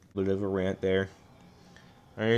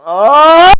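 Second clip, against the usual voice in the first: very high, very loud startled shout; sudden yelp; 14 semitones higher, 17 dB louder than usual. Real, not acted. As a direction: burst out at the wrong button press. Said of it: "I think I just broke my mic".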